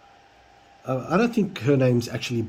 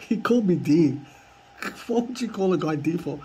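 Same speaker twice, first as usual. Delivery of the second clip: laughing; 3 semitones higher than usual. Real, not acted.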